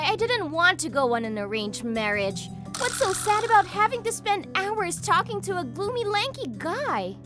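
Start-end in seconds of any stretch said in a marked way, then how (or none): 2.8-7.2 high-pitched, exasperated rant; scornful complaint. Acted. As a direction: complain with open disdain. Woman insults her date.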